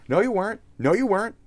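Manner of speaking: very high, sing-out contradiction; exaggerated high-pitched rebuttal; mock scolding retort. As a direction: shoot the pitch up to jeer back. Retort to the mocked colonizers.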